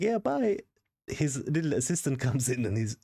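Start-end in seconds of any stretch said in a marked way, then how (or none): 0.0-0.6 much higher, louder mocking doctor voice; mock doctor brush-off. Doctor leaving the room, hammed-up mocking brush-off. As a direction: toss it off like a careless doctor. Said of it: "He goes, like".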